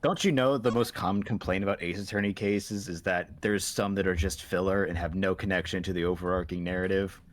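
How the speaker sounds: usual speaking voice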